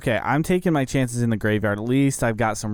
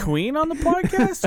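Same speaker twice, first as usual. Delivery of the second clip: high-pitched joke voice. Pretend. Joking about high singing.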